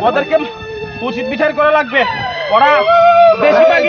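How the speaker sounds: loud, high shouted protest; furious insistence. Acted. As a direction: shout down whoever tries calming you. Refuses to be calmed.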